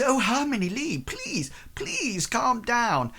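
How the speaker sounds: high, gentle pleading calm-down; a hint of a soothing mother. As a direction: soothe an overwrought daughter. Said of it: "the woman says".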